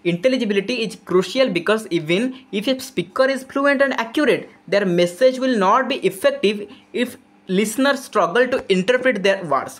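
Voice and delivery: slow, deliberate reading of an English text; reading out definition. Acted. Teacher reads the textbook definition to students, slowly. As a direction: spell it out slowly for the class.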